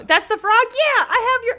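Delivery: loud, high burst of delight; excited recognition. Real, not acted. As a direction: exclaim as you suddenly realise who it is. Recognises viewer's crocheted frog.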